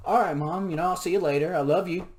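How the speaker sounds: hammed-up loving farewell; loud cheery send-off to mom; loud, high goodbye. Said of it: "I was like".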